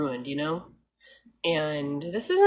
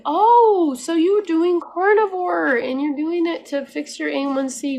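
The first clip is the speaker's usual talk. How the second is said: higher, louder, slowed, incredulous; incredulous mock questioning; 9 semitones higher, 7 dB louder than usual. Imagined talk to cheating dieters, higher, louder, incredulous. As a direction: grill the cheater in disbelief. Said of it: "you think".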